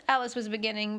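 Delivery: ordinary delivery, nothing marked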